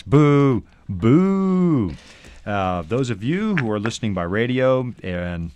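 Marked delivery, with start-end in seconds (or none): 0.0-1.9 loud playful boo; mock booing. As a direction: jeer the pun like a crowd.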